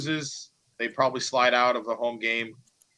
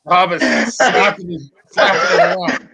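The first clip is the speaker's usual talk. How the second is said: laughing; 5 semitones higher, 11 dB louder than usual. Real, not acted. Laughing through the retelling.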